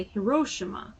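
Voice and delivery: Hiroshima with stress on RO, reduced "shi"; American pronunciation. Shown as a demonstration. Showing how some Americans say it, Hiroshima stressed on RO. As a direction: say Hiroshima as hi-RO-shuh-ma. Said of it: "in America, they say".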